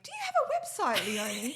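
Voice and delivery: mock friend Bron. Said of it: "our friend Bron was like".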